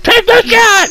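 very high, loud mock-desperate shout; panicked yell. Acting out a standoff over a cat in a headlock, mock panicked yell. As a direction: yelp it at the top of your range. Acts out cat's hostage in headlock.

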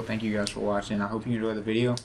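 normal talking voice

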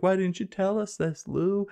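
high-pitched imitation of upset friends; mock distress. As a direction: plead in a put-on high voice. Friends' distressed reply acted out.

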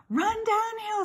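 high cow voice calling advice; high, urging animal voice; cows' suggestion. A narrator's reading. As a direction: call it out as a cow, pitched high. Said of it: "suggested the cows".

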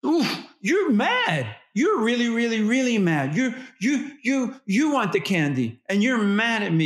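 put-on mirror the child's anger in big simple phrases; toddlerese mirroring. Shown as a demonstration. Parent narrating a toddler's anger back to them, high and sing-song. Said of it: "you narrate it back saying".

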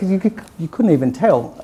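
normal talking voice

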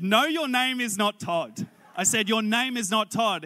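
insistent, high and loud denial; loud disbelief. Retold or imitated. Preacher disputing his seatmate's claim to be Todd, loudly insistent. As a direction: refuse to believe it, punching the words.

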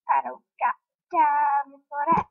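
sassy meme line, rising end; meme quote voice. Pretend. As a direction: quote the viral clip with attitude.

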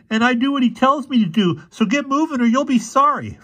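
put-on very high, loud boy's voice; mean teenage boy, defiant. A narrator's reading. Boy guarding the house threatens intruders, defiant. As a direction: warn them off in a high, pushy voice.

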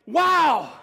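loud, high amazed wow; awed wow. Retold or imitated. Preacher voicing Paul's amazement, loud high wow. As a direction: gasp it out in wonder. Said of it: "It's like he's saying".

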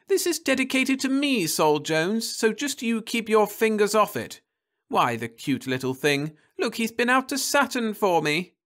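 high, indignant then doting; Florence's voice. A narrator's reading. Wife rebuffing her husband, high and indignant. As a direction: snap at him, then coo over the diver.

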